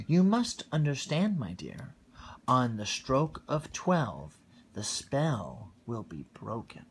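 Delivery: lively read-aloud storytelling voice; storybook reading. A narrator's reading. Parent reading Cinderella to a small child, lively storytelling voice. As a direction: read it like a bedtime picture book.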